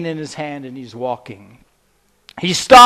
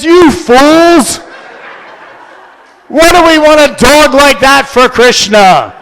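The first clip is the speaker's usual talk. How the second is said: higher, louder rebuke; mock scolding; 7 semitones higher, 7 dB louder than usual. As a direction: scold them, half joking. Prabhupada scolds disciples.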